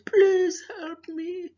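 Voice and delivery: high, slow begging plea; pleading lion. Acted. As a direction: beg slowly, trapped and desperate. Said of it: "The lion said".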